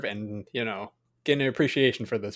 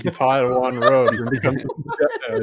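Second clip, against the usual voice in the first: mock dramatic reading; 8 dB louder than usual. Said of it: "Let me recite it to you".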